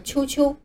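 QQ wrongly said as "秋秋", chyo-chyo; "秋秋" as a common misreading of QQ; mispronounced QQ. Shown as a demonstration. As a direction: say "秋秋" with a ch sound in place of k. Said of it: "这些全都是错误的读法".

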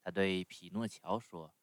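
ordinary delivery, nothing marked

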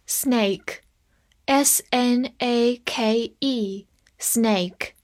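very slow letter-by-letter spelling; drawn-out dictation; spelled out slowly. Shown as a demonstration. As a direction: spell each letter out for the class. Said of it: "spelling out the word".

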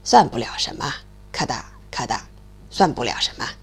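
put-on low, gruff old stork. The stork grumbles low at the noisy mole. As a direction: grumble low like a big bird.

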